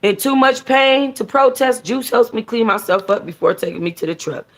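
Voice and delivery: usual speaking voice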